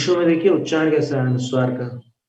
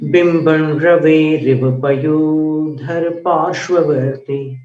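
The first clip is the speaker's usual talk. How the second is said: verse recitation; 5 semitones higher, slower than usual. Shown as a demonstration. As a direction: recite each line with care.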